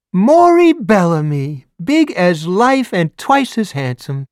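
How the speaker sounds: high, slow syrupy busybody; high fawning old neighbor voice; gushing neighbor. A narrator's reading. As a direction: lay the flattery on thick. Nosy neighbor gushing at Morris.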